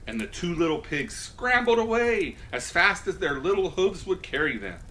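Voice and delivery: plain reading voice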